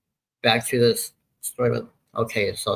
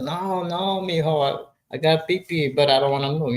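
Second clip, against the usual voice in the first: higher mock of an older Puerto Rican woman; friend's voice; 6 semitones higher than usual. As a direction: pitch it up to play the friend who won't get up. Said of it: "she said".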